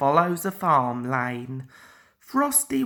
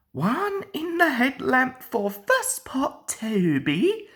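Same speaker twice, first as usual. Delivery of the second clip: higher gleeful gloat; chortling; 8 semitones higher than usual. A narrator's reading. Mavis gloating over Toby as traffic halts. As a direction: crow it with a chuckle. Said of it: "chortled Mavis".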